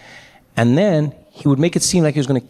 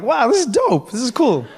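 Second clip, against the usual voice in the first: high, wowed enthusiasm; impressed delight; 7 semitones higher than usual. Acted. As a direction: react like a thrilled spectator. Acting out his impressed reaction.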